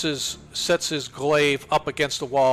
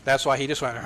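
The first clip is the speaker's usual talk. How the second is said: usual speaking voice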